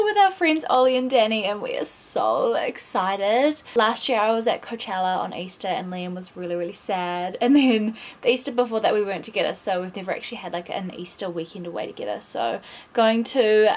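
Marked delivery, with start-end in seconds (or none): none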